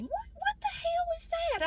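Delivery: high, slow, disbelieving squeak; stunned, squeaking at the screen; panicked muttering. Retold or imitated. Talking to herself, panicking over the numbers. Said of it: "just talking to myself".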